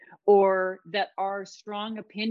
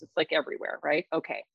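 normal talking voice

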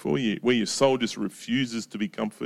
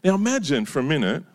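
usual speaking voice

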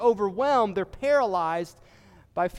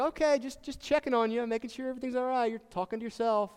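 a lightly voiced wife, pitched up and quick at the end; wife checking in; 5 semitones higher, 5 dB quieter, faster than usual. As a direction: gently check on him in her higher voice. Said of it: "she's like".

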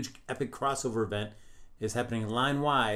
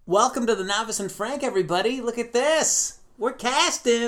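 excited welcome; 12 semitones higher, 7 dB louder than usual. Acted. Show opening.

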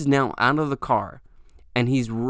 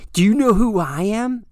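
higher, louder puffed-up bully; menacing threat; 9 semitones higher, 6 dB louder than usual. Retold or imitated. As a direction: play the self-important bully. Retold threat from angry driver.

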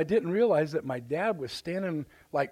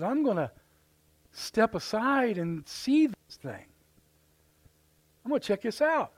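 higher, drawn-out mock curiosity; curious Moses; 7 semitones higher than usual. Preacher acting Moses deciding to inspect the bush, curious and high. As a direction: act out intrigued deliberation, pitch up.

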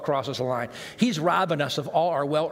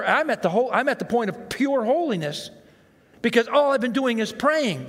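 worked-up rant; 5 semitones higher, 4 dB louder than usual. Real, not acted. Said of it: "worked-up rant about praying nonstop".

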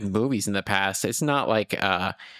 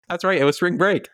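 normal talking voice

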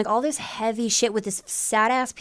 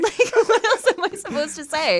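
laughing exasperation; 11 semitones higher, 7 dB louder than usual. Real, not acted.

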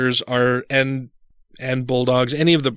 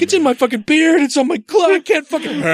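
freaking out; 13 semitones higher than usual. Retold or imitated. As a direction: wail in disgusted despair. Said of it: "And I'm like".

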